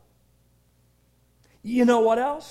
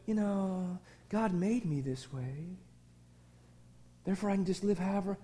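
soft, low, dismissive shrug of a voice; mock a careless sinner's excuse; mock lazy excuse; 4 semitones lower, 8 dB quieter than usual. Preacher mocking a complacent believer's excuse, softly. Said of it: "for us to sit back and go".

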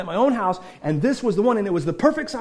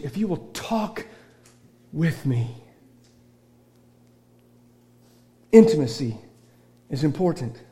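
softer, lower intimate sermon voice; quiet preaching; 6 semitones lower, 4 dB quieter than usual. Acted. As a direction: draw them close with a hushed appeal. Soft intimate preaching stretch.

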